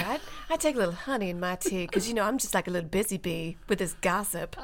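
soft genteel mock Southern; sweet put-on Southern gossip; fancy Southern accent. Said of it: "I like the fancy".

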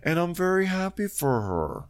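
a hint of fake-happy Kathy; imitating Kathy. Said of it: "And Kathy goes".

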